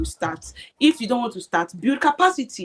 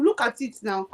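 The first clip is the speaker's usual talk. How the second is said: ordinary talk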